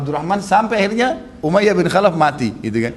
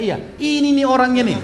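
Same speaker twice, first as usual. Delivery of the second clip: high, raised cry of recognition; excited accusation; 10 semitones higher than usual. Retold or imitated. The Ansar recognise the man who tortured Bilal, excited accusation. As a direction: point out the culprit excitedly. Acted men identifying the hated captor.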